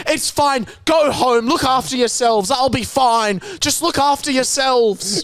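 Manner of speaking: lightly voiced grandma waving them off, up high; brave grandma. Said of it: "Barb starts going".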